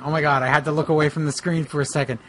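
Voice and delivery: ordinary delivery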